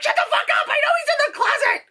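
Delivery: very high, mock-furious squawk; hammed-up shrieky irritation; exasperated outburst. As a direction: blow up at a chattering moviegoer. Exasperated retort to moviegoer.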